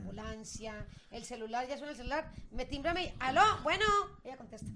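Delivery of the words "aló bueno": high, loud phone greeting; bright mimicked hello, pitched up; parrot answering the phone. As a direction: imitate a parrot picking up. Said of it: "Ella imita voces".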